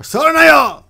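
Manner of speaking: loud, high, forceful warning; stronger command. Shown as a demonstration. Teacher demonstrating the stronger intonation of don't touch, forceful shout. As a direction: shout it as a sharp order to back off. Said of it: "could be stronger".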